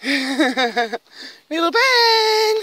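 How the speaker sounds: stretched high call through a laugh; high laughing dog call. Real, not acted. Laughing as she calls her dog Ben back, high and slow.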